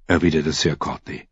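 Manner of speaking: ordinary delivery, nothing marked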